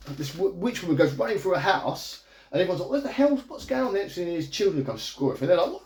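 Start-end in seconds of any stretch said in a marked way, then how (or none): 2.9-3.9 high-pitched startled bystander; mock alarm. Householders as the witch runs through, high-pitched mock alarm. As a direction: pitch it way up in bewildered panic.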